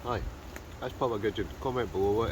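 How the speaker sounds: talking through a mouthful; mouth full. Real, not acted. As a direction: say it while chewing food. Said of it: "my mouth full".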